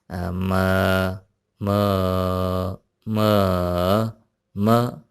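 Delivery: म said flat, rising, dipping and falling in turn; four tones on म. Shown as a demonstration. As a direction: run म through all four tones. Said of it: "उसमें से हम एक example ले लेते हैं". (म को चारों टोन में बोलना)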